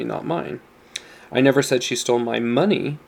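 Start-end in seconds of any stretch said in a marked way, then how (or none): 1.3-3.0 "money" punched as the final word; stress on MONEY. Shown as a demonstration. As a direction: save the emphasis for "money" at the end. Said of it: "depending on the emphasis you give".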